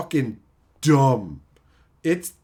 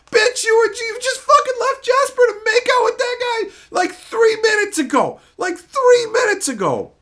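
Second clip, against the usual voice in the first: high, loud exasperated yelling; much higher and louder indignant rant; outraged rant; 7 dB louder than usual. Real, not acted. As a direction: yell at the screen in disbelief. Ranting at the TV character.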